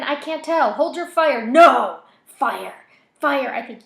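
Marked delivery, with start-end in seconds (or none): 0.0-1.5 loud, higher tense shout. A narrator's reading. Officer shouts. 1.5-3.5 loud frantic shouts; screaming. A narrator's reading. Voices scream.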